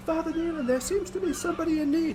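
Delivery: high, flustered voice growing from faint to loud. Acted. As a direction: start distant and hushed, build to loud and urgent. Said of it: "it's getting louder as you get there".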